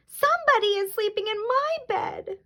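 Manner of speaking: very high, tiny bear wail; tearful little storybook bear voice; little wee Baby Bear voice. A narrator's reading. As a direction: squeak it like a whimpering cub. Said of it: "in his little wee voice".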